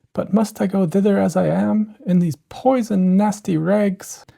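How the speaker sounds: worried about her rags; Cinderella. A narrator's reading. Cinderella voiced in the story.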